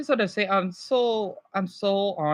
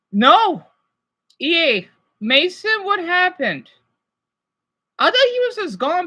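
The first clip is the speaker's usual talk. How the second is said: high shocked question; stunned, asking what happened; 9 semitones higher, 5 dB louder than usual. Real, not acted. Shocked by viewer news.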